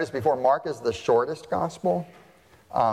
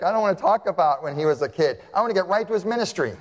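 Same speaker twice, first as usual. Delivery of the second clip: fast, eager hurry to the point; impatient Mark; 5 semitones higher, faster than usual. Retold or imitated. Mark impatient to skip the childhood, fast eager voice. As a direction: rush it like you can't wait. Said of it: "He's like".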